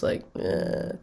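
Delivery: breathless croak showing a sick voice; croaky sound. Shown as a demonstration. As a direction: let the voice crack into a gross croak. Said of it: "and gross".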